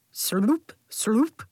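sloop with a rising slide; playful sucker sound effects; slurping sound words. A narrator's reading. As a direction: make each word slurp upward.

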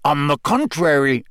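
growled. A narrator's reading. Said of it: "growled Moody".

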